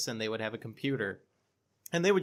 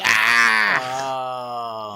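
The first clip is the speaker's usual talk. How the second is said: sudden loud imitated scream; mock horror-video scream; jump-scare scream; 12 dB louder than usual. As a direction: blast out a short startled "Ah!".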